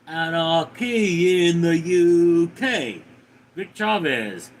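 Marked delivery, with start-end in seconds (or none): 0.1-3.0 put-on slow, louder line in a Russian accent. Streamer answers chat slowly in a hammed-up Russian accent. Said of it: "pretty good Russian accent".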